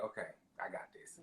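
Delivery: quietly steel yourself through tears; whispered. Retold or imitated. Friend imitating her fighting tears, whispered.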